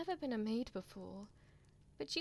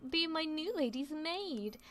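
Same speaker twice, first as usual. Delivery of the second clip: excited; 4 semitones higher, 3 dB louder than usual. Acted. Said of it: "her voice aglow with excitement".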